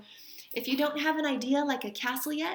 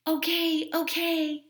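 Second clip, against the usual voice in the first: higher, louder eager call; 6 semitones higher, 6 dB louder, slower than usual. Acted. Henry and father inviting mother down, higher louder call. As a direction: call her down, ready at last. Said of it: "they called".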